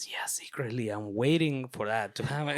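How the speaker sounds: softly, as a private admission; secretive aside. Retold or imitated. Student's secret reply after being shushed on the hunt, quiet aside. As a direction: drop the voice for a sly aside.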